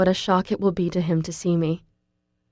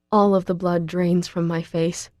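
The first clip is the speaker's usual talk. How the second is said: ordinary delivery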